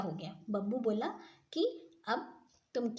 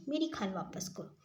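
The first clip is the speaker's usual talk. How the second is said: ordinary delivery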